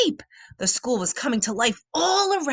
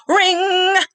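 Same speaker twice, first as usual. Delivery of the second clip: bell ring; 9 semitones higher, 5 dB louder than usual. A narrator's reading. School bell rings.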